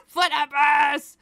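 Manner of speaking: laughing shouted punchline. Real, not acted. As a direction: yell it out through laughter.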